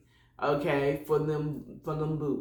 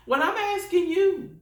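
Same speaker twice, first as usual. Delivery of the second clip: exasperated protest; 11 semitones higher than usual. Real, not acted. Arguing back at God.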